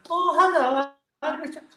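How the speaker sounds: very high, softened squeaky English hello; squeaky English accent. Retold or imitated. Storyteller mocking how others heard him, squeaky English accent. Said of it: "this guy with a squeaky English accent".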